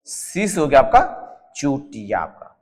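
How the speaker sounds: slow and deliberate, spelling out Chutia for memory; slow, emphatic teacher repetition; slow drilled repeat. Shown as a demonstration. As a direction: drill the line slowly so it sticks. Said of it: "teacher repeats mnemonic slowly".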